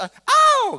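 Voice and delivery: high, loud mock "ow"; imitated pain yelp. Shown as a demonstration. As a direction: yelp as if just pricked, drawn out. (pura-pura menjerit kesakitan)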